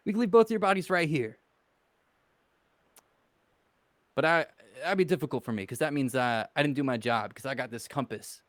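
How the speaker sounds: lightly put-on accent picked up in Vegas. Character warns two gentlemen in his borrowed Vegas accent. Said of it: "why I talk like this with this accent".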